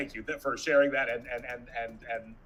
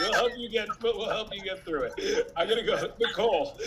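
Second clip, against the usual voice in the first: laughing; 10 semitones higher, 4 dB louder than usual. Real, not acted. Host laughing at camera chaos.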